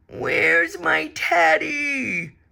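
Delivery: high, slow whine; whining bear. A narrator's reading. The bear calls for his teddy, slow whine. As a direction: whine it out, a big lost bear. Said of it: "A great big voice whined".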